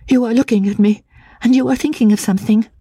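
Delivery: high, rushed and breathless; breathless rapidity. Acted. Anne to the narrator at the grave, high and rushed. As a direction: tumble the words out fast. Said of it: "her strange, breathless rapidity of utterance".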